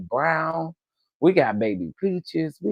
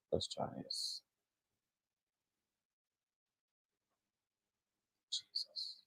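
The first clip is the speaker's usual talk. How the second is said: barely voiced frustrated mutter to herself; whispered aside while fiddling with settings; 22 dB quieter than usual. Real, not acted. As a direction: breathe the words out under your breath, annoyed. Frustrated with tech setup.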